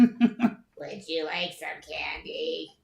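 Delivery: put-on very slow, laughing creepy old lady; creepy crone voice. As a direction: drag it out like a creepy stranger. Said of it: "scratchy voice crone from next door".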